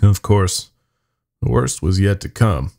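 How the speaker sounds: ordinary delivery, nothing marked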